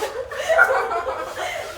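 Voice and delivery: high, rising giggle; giggling laughter. Real, not acted. Sister after tying scarf too tight, high giggling. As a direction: giggle helplessly after the mishap.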